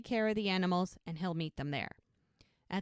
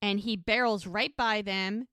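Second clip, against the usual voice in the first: usual speaking voice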